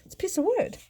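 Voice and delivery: high, flat childish mock voice; kids unimpressed. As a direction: imitate the kids being underwhelmed. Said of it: "They're like".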